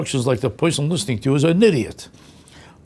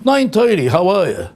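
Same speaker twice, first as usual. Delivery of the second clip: higher, cheery time-stamp hello; mock greeting with time; 5 semitones higher, 4 dB louder than usual. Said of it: "do you ever say to them".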